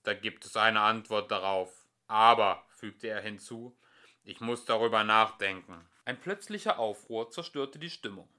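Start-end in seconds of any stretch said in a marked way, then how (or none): 0.0-5.8 lower, deliberate machine voice; lower, calm computer; ponderous computer. A narrator's reading. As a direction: weigh every word like a vast computer. Computer voice held.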